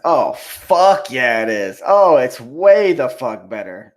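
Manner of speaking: loud, drawn-out, slow agreement; big, slow, booming endorsement; emphatic boast. Real, not acted. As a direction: crow it loudly, stretching the key words. Mock boast answering American is better.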